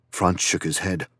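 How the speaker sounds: plain reading voice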